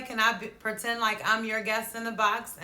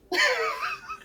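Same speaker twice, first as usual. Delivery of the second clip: short high laugh bursting out; high giggle; 4 dB louder than usual. Real, not acted. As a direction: giggle at your own joke. Laughs at her own story.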